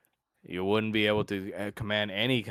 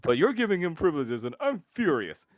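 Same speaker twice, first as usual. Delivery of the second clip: higher, louder whiny protest; mock furious complaint; 9 semitones higher, 5 dB louder than usual. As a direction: complain at the favoritism, hammed up. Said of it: "he goes like".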